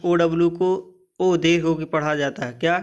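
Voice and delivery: ordinary talk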